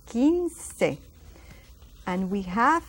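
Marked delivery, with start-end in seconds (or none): 0.1-1.0 "Quince" as KEEN-seh, higher pitched; a plain K starting "Quince", no W; silent U. Shown as a demonstration. Teacher repeating the correct form, "Quince" with silent U. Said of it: "that U is silent".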